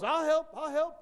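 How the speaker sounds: high, loud eager volunteering; eager big-mouth girl. Retold or imitated. Cheerleader volunteers to teacher, mock eager shout. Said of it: "big mouth".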